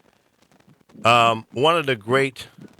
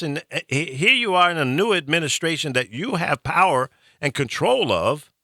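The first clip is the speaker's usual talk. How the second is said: ordinary talk, nothing marked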